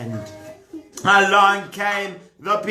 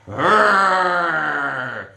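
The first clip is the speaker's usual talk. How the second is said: a playful growl of fury; angry growl. Acted. King Shaul hearing the news, mock growl. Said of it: "Shaul suddenly got furious".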